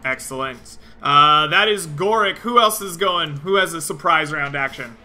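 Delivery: usual speaking voice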